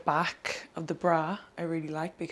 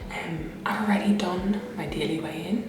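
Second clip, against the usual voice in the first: whispering. Real, not acted. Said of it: "I'm having to whisper this morning".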